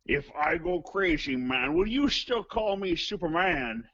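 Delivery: drawn-out, hammy mock-Scottish delivery; Sean Connery. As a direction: recite karaoke lyrics as a shitty Connery. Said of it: "really shitty Sean Connery impression".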